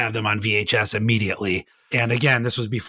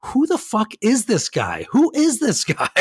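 high, bewildered mock outburst, much higher pitch; baffled dude; 11 semitones higher than usual. Retelling the movie scene to his cohost, a high baffled mock voice. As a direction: play the confused dude who can't believe this guy. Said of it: "the dude keeps stopping and goes".

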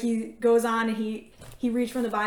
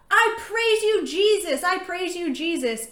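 high, loud cry of praise, easing down; imitated possessed girl; 8 semitones higher, 4 dB louder than usual. Possessed girl praises Jesus from a chair, imitated changed voice. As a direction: imitate the girl's changed voice, pitched way up. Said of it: "the voice that she had".

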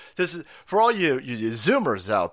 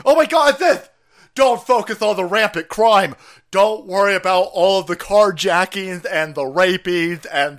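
loud, hammed-up mock panic; mocking alarmist; 2 semitones higher, 8 dB louder than usual. Mocking climate worriers over crime, loud sarcastic alarm. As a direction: loudly parody a climate worrier.

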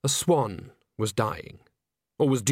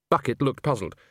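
ordinary delivery, nothing marked

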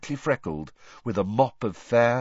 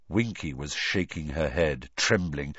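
ordinary delivery

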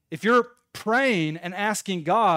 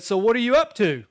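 loud, higher casual question; stall neighbor's voice; 5 semitones higher, 7 dB louder than usual. Retold or imitated. Man in the next stall seems to chat, loud nosy voice. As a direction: call over a chatty question, lifting the pitch. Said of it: "the voice in the stall next to me".

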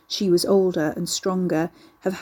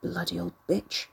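muttered under breath; 7 dB quieter than usual. A narrator's reading. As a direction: spit it out silently in your head. Ricky's silent inner curse.

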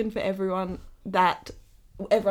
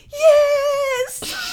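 high excited squeal; cheering yes; 9 dB louder than usual. Retold or imitated. Cheering an Australian win at the Olympics, squealing. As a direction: cheer like Australia just won. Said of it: "I'm like".

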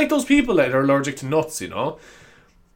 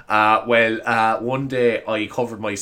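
ordinary delivery

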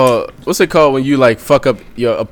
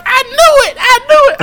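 high, loud mock shriek; hammed-up high-pitched cry of vindication; acted outburst; 4 dB louder than usual. Woman catches out her man, high mock outburst.

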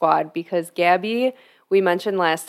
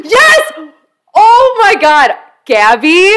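high, loud thrilled squeal; excited shout; 10 semitones higher, 8 dB louder, slower than usual. Real, not acted. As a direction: squeal with delight. Guest reveal guessed right.

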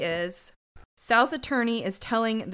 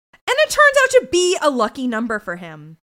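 normal talking voice

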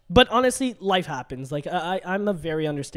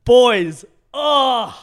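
mock outburst; 6 semitones higher, 11 dB louder than usual. Acting out own frustrated outburst.

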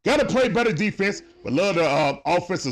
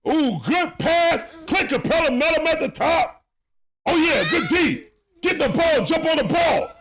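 excited play-by-play; 5 semitones higher than usual. Real, not acted. Streamer calling the Hawks game live for his chat, excited play-by-play. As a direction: call the action like a hyped fan commentator.

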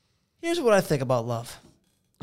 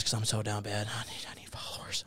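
whispered confession, half joking; whispered joke; 3 semitones lower, 6 dB quieter than usual. Acted. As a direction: whisper it like a secret. Joking aside about followers.

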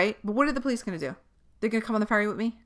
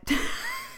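sudden laugh; 4 dB quieter than usual. Real, not acted. Bitter laugh at pandemic irony.

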